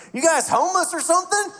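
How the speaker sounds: high, incredulous question; imitating the stranger. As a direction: ask it in high-pitched disbelief. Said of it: "looks over at me and goes".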